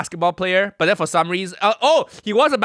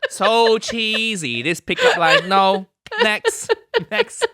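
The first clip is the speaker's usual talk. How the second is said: hammed-up accented uncle character; Uncle Roger; 2 semitones higher than usual. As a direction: turn them down in a thick mock uncle accent. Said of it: "coax Uncle Roger out".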